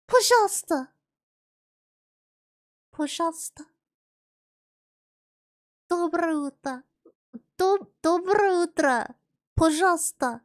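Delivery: put-on high, loud, playful Russian accent; hammed-up Russian, punching the words out. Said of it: "hear my Russian accent".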